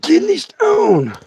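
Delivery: high, slow, strained kidney stone; agonized squeak. Retold or imitated. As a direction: squeak it out in agony. Telling nurse, in agony.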